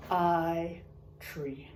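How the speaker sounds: ordinary delivery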